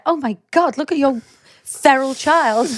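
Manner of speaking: judgy onlookers. Retold or imitated. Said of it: "people are just looking at me like".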